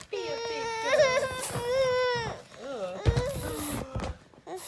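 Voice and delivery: high, slow wailing mock cry of "big girl"; hammed-up whiny cry at the baby. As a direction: stretch out "Be a big girl" like a fake sob.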